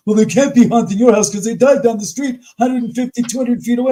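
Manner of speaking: mocking imitation. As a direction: ridicule what people claim. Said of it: "People say".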